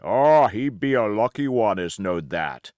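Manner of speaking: a sneering sailor mocks the boy; rough pirate voice. A narrator's reading. Buccaneer jeering at Jim, loud and rough.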